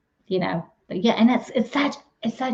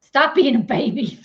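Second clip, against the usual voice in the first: higher, louder, laughing scold; laughing tease; 4 semitones higher, 5 dB louder than usual. Real, not acted. Wife teasing her complaining husband, laughing tease.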